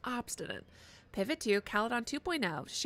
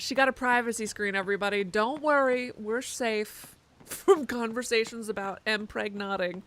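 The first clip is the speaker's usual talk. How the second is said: ordinary talk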